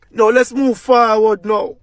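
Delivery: ordinary talk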